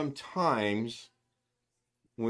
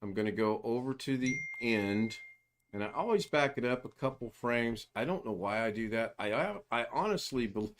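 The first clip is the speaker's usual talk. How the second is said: ordinary talk, nothing marked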